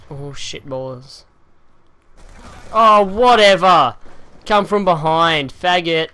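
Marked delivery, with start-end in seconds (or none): 2.7-6.1 loud, slow furious yell; angry outburst. Real, not acted. Gamer shot from behind, shouting in anger. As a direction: blow up at the unfair kill.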